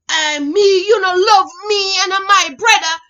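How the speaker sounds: put-on loud, high Jamaican accent, accusing; imitated Jamaican boy. Boy accusing her of loving a Yankee, in loud Jamaican accent. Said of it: "This how they talk".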